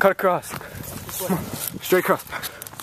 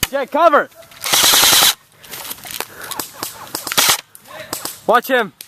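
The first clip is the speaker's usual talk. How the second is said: loud, high urgent shout; shouting orders over battle; 9 semitones higher, 4 dB louder than usual. Real, not acted. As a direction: yell for cover, urgent.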